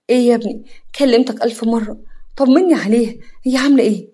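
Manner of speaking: loud, urgent pleading; anxious eagerness. Acted. Nagwa anxiously begging Hatem for news of her daughter. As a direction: press him for news, worried. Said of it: "نجوى بلهفة".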